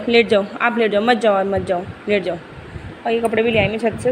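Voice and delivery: ordinary delivery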